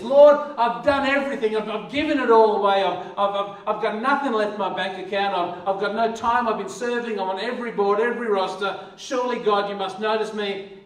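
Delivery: a churchgoer loudly listing all they've done for God; aggrieved complaint to God. Retold or imitated. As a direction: plead your case, indignant and loud.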